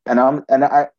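ordinary talk, nothing marked

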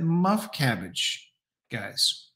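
put-on "Muff cabbage" very slow, a light Jersey accent. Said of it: "in the Jersey accent".

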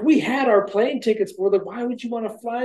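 high-pitched retold complaint; acted-out exasperated scolding; indignant protest. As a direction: protest in comic disbelief. Rick berates the pilot.

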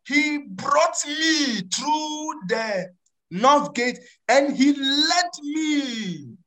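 drawn-out, deliberate reading of the verse; slow scripture reading. Acted. Preacher reading a verse to his congregation, very slowly. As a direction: lay each word of the verse down slowly.

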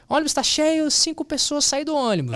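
high-pitched imitation of a bus announcement; mock crowd-addressing call; announcing to passengers. Man announces to the whole bus.

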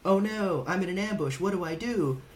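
high, hammed-up panic; mock panic. Soldier frozen in an ambush, mock panicked. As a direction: play the soldier who freezes.

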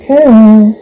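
a high, loud yell of the name; shouting a name. Acted. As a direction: yell her name across the fight. Calling out to Kelly in fight.

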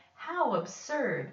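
a mocking, jeering yell; taunting. A narrator's reading. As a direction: tease the poor elephant. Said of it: "they yelled".

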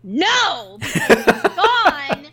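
loud, high, drawn-out exclamation with a laugh; exasperated shout, half laughing; comic outrage. Real, not acted. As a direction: wail it out in mock fury. Comic outrage at missing treat.

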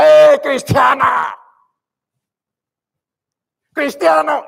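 drawn-out yell across the street; retold shout. Strange half-naked man shouting at the preacher, drawn-out yell. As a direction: bellow it slowly at the passerby. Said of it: "me gritaba".

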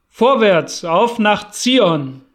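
laut und langsam, Zion gedehnt; lauter Aufbruchsruf. A narrator's reading. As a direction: ruf die Karawane zum Aufbruch. Anführer ruft zum Aufbruch. (langsamer, lauter Ruf nach Zion)

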